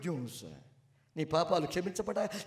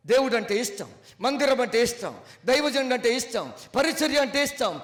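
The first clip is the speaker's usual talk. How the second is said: louder and higher with each line; building sermon refrain; 5 semitones higher, 7 dB louder than usual. Acted. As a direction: stack the refrain to a peak. Preacher builds up.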